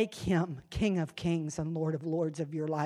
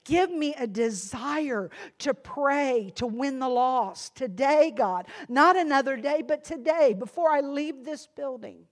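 rising, urgent plea to God, louder and higher; fervent prayer; 5 semitones higher, 5 dB louder than usual. Acted. Preacher leading the church in prayer, urgent and loud. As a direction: pray it out with urgency.

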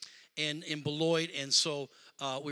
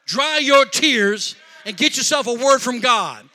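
full-force preaching; 6 semitones higher, 14 dB louder than usual. Acted. Preacher urging the congregation off the floor, shouting. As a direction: call the whole room to get up.

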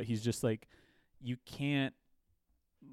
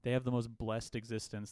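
ordinary talk

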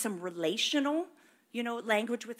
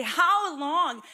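a raised, anguished how long; agonized plea; 6 semitones higher, 6 dB louder than usual. Retold or imitated. Someone in agony crying out to God, raised and pleading. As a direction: cry it out like someone at the end of their rope. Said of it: "they're completely in agony".

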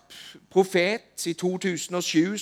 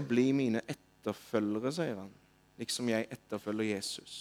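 solemn quote; 6 semitones lower, 9 dB quieter than usual. Retold or imitated. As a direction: quote Paul gently and gravely. Quoting Paulus, solemn. (lavt og høytidelig)

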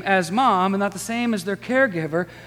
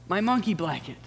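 normal talking voice

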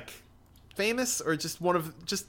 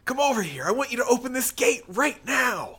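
higher, a bit louder, urgent; urgent commanding priest; 10 semitones higher, 4 dB louder, faster than usual. Acted. The priest urges the guard to open the gate. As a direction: press him to open the gate now.

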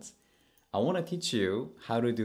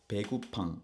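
cute aegyo; 6 dB quieter, much faster than usual. Shown as a demonstration. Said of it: "When you wanna say in a cute way".

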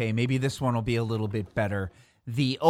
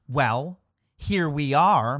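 ordinary talk

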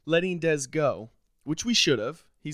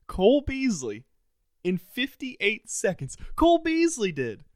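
much higher and louder, outraged emphasis; outraged high-pitched insistence; indignant; 8 semitones higher, 4 dB louder than usual. Real, not acted. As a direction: yell the name in disbelief. Ranting about the star receiver.

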